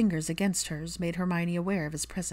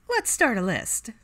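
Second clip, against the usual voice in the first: higher, slow sarcastic announcement; Pansy voice; 5 semitones higher, 4 dB louder than usual. A narrator's reading. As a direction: savour the moment, sarcastic.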